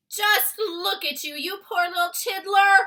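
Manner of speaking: high, loud tender pity; fond cry. A narrator's reading. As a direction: coo over her with pity. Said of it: "cried the B F G".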